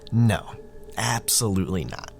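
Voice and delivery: ordinary delivery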